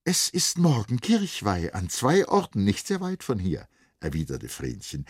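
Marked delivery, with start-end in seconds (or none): 0.0-3.7 hohe, helle Mädchenstimme, sehnsüchtig und fröhlich; junges Mädchen. Acted. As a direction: sprich als verliebtes Mädchen, das tanzen will. Vrenchen antwortet Sali. (höhere Mädchenstimme, verträumt)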